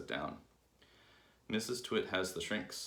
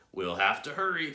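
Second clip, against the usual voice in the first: loud, higher, pressing; urgent warning; 6 semitones higher, 8 dB louder than usual. A narrator's reading. Husband hurrying his wife to the cure, urgent. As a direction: urge it firmly and loudly.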